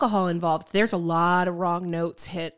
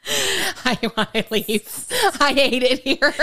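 mock customer-service greeting; 3 semitones higher, much slower than usual. Put-on service voice.

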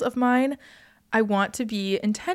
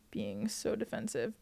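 slow, much softer, half to oneself; writing it down; 13 dB quieter than usual. Real, not acted. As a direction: murmur it slowly as you write. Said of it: "I'm writing this down".

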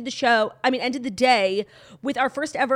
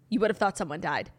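ordinary delivery

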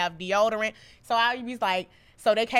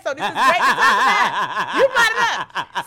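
laughing; 4 semitones higher, 6 dB louder than usual. Real, not acted. Talking through laughter.

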